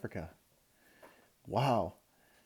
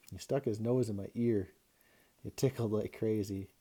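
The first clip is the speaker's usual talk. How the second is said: ordinary delivery, nothing marked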